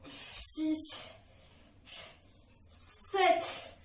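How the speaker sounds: breathless strained counting; doing ab exercises. Real, not acted. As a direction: count out of breath mid-rep. Counting reps while doing abs. (comptage essoufflé pendant l'effort)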